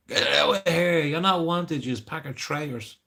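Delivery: mock heckle. Imitating locals shouting at canvassers, mock heckle. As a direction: jeer it like a hostile passer-by. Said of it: "without people saying something".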